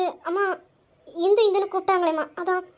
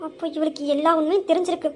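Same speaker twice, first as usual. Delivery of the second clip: ordinary talk, nothing marked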